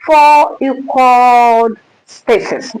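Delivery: slow, deliberate "four equal spaces"; slow teacher's emphasis on "four equal spaces"; emphasized. Shown as a demonstration. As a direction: press "four" and "equal" hard. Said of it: "I emphasize again".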